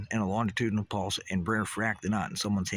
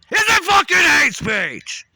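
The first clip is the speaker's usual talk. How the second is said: slow, much higher, much louder yell; furious shout; 13 dB louder, slower than usual. Shown as a demonstration. Ranter demonstrates the anger signal to viewers with a furious yell. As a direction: roar it out in rage. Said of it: "You see how this works?".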